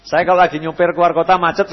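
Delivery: ordinary talk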